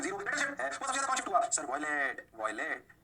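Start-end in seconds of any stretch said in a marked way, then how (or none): 1.6-2.9 low, soft pupil voice; student answer. Acted. Student answering sir's slab question, low student answer.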